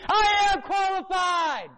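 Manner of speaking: high, slow triumphant yell; high, drawn-out yell of I am qualified; shouted. Retold or imitated. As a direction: shout it out like a victory. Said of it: "You better yell it".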